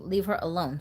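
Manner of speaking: ordinary talk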